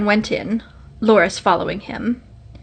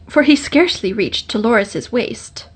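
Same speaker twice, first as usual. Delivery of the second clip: plain reading voice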